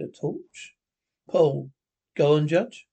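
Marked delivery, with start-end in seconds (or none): 0.0-0.7 a touch of fear in a hushed question; mildly frightened, softer and higher; scared. Acted.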